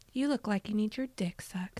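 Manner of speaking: low, whispery come-on; hushed pickup line. Retold or imitated. Woman retelling her pickup line to guys, whispered. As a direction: drop to a near whisper.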